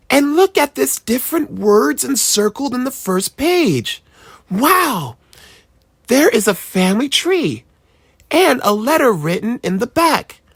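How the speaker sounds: put-on high, wondering boy voice; a kid marveling at a find; amazed child. A narrator's reading. Boy showing the book to his parents, amazed child voice.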